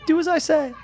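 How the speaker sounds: high put-on old woman voice; quoting the old lady. Friend quotes the movie character's line to his buddy, in a high mock voice. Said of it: "She always says it".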